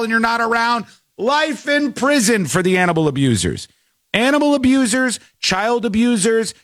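outraged host; emphatic demand. Real, not acted. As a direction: lay down the sentence like a verdict. Host ranting about animal abusers.

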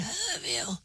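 very soft, barely audible mumble; sleepy mumble. Retold or imitated. Guy in bed in the middle of the night, sleepy mumble. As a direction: murmur it as if half asleep. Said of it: "in the middle of the night".

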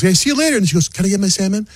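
high bright wife voice; lightly mocked wife farewell; husband voices wife asking for her salmon; imitating wife.

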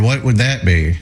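normal talking voice

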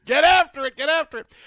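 high-pitched mock holler; sideline yelling. Host joining co-host's sideline act, mock yelling. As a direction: join in urging players on from the sideline.

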